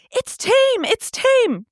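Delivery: high-pitched imitation of the bird owner; mocked Karen. Karen calling to a fleeing walker about her bird, high mocking voice. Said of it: "Total Karen".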